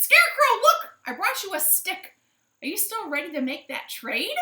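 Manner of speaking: high, loud excited chicken voice; high-pitched hen calling out. Acted. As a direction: squeal it out like an eager little hen.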